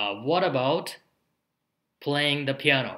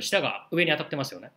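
ordinary talk, nothing marked

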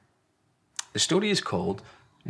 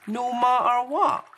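high, louder, slow delighted question; Small, hopeful little one; 6 dB louder than usual. Acted. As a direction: ask hoping it's true. Small checks, pleased.

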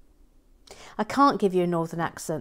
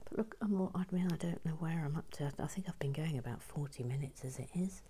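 soft hushed whisper while building; 3 semitones lower, 15 dB quieter than usual. Real, not acted. Host murmurs to viewers while finishing the bag, whispered. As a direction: keep it hushed and close as if not to wake anyone.

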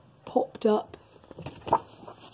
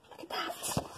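whisper; 17 dB quieter than usual. Real, not acted. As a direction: lean in and whisper. Aside to child listener.

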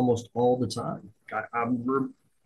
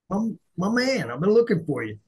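mock friendly; 7 semitones higher than usual. Chief buttering up the NCO, hammed-up and higher. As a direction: lay the friendliness on thick.

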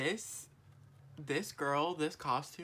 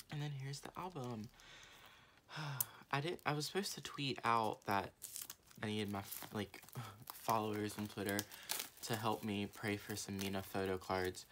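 usual speaking voice

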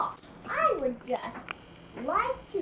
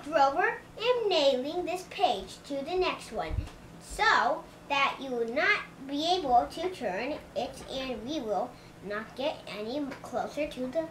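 ordinary delivery

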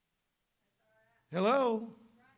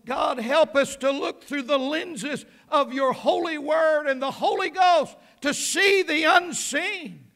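loud, higher, full-force prayer; preaching shout; 4 semitones higher, 6 dB louder than usual. Real, not acted. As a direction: cry it out to God at full force.

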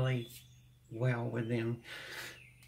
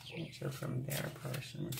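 soft whispered repeat of the phrase; hushed echo under the breath; 10 dB quieter than usual. Real, not acted. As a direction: breathe it back to yourself. Whispered echo of card message.

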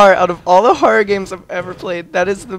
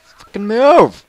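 slow loud annoyed outburst; frustrated yell; 6 semitones higher, 7 dB louder, much slower than usual. Real, not acted. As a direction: snap at it in frustration. Frustrated at blocking npc.

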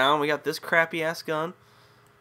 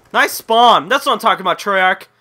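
loud, raised irritated complaint; annoyed; 4 semitones higher, 9 dB louder than usual. Real, not acted. Gamer to Treyarch about a spawn, annoyed. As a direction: throw it angrily at the developers.

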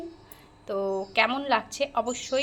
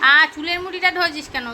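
sudden loud, higher protest; startled yelp; 4 semitones higher, 6 dB louder than usual. Real, not acted. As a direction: yelp as your hair is pulled. Hair grabbed while feeding.

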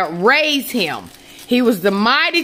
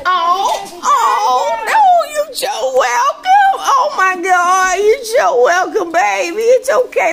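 much higher, laughing and half crying; overwhelmed, choking up with laughter; laughing through tears; 11 semitones higher than usual. Real, not acted. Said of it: "Please, I'm emotional".

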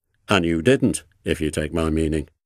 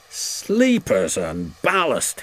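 slow, raised exclamation of dismay; exclaimed; 5 semitones higher than usual. A narrator's reading. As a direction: cry out the mild oath, drawn out. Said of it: "he exclaimed".

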